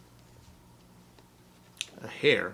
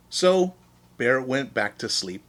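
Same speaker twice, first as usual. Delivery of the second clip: plain reading voice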